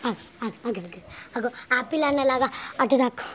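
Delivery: very soft hushed whisper; whispering while hiding. Real, not acted. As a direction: whisper so nobody hears. Hiding during game.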